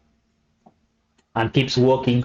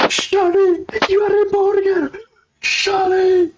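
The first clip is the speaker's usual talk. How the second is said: very high, crying out the name in grief; guard wailing over a friend. Acted. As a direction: wail for your friend turned burger. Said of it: "one guard goes like".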